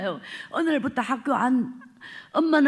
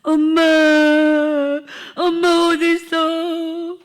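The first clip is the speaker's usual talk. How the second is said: higher, louder call, last word long drawn out; desperate calling out; 5 semitones higher, 8 dB louder, slower than usual. Retold or imitated. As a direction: cry out for her through the house. Child searching for mother.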